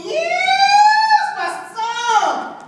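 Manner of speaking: very high, loud joyful yell; whooping along with the jazz band; ecstatic yell. Retold or imitated. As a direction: cry out in wild delight at the music. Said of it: "I yell within".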